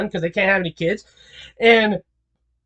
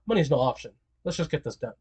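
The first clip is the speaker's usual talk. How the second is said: low, much softer; quiet, dismissive calm; soft Muzan; 8 semitones lower, 10 dB quieter, faster than usual. Retold or imitated. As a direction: Muzan brushing off the cost, softly. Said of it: "the, or".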